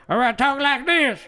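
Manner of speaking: mad voice. Shown as a demonstration. Streamer demos his mad voice, loud and high. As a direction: show how you sound when mad. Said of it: "depends on how fucking mad I am".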